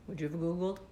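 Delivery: quiet, worn-out asking; soft, defeated question. Real, not acted. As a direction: ask Googled quietly, drained. Asking viewers.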